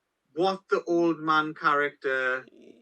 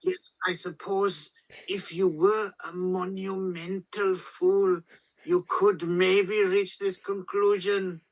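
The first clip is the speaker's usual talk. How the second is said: deliberate, higher-pitched mock German director; Werner Herzog; 4 semitones higher than usual. Host imitates director insulting someone roundaboutly, measured disdain. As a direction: insult politely, in a measured auteur impression. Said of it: "great roundabout way of, like, of insulting someone".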